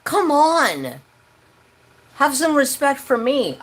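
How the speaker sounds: scolding the visiting family; pleading anger. Retold or imitated. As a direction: demand some respect. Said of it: "I was like".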